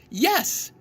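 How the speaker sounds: Frog's bright happy cry; joyful cry. A narrator's reading. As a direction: cheer at the flying kite. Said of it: "cried Frog".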